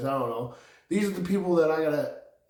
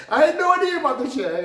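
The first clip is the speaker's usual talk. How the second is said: loud, high and quick through laughter; laughing outburst; 9 dB louder, faster than usual. Real, not acted. Confessing ignorance to viewers, laughing loudly. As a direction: burst out laughing, rushing the words.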